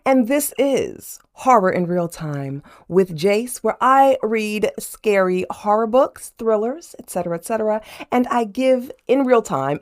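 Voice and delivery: bright, high showman's welcome; channel host intro. Acted. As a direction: greet the viewers like a spooky TV host.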